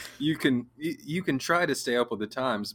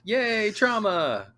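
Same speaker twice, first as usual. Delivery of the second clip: sarcastic cheer; 9 semitones higher, slower than usual. Pretend. Sarcastic cheer at a sad story.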